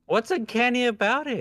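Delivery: indignant disbelief. Real, not acted. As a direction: protest in shocked disbelief. Defending her furry model.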